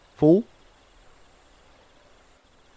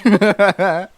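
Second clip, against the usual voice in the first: laughing; 5 semitones higher, much slower than usual. Real, not acted. Laughing at own joke.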